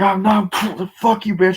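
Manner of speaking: high, loud mock fight yell; animated retold shouting; acted angry outburst. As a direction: hurl the insult as in an action scene. Acted fight in film retelling.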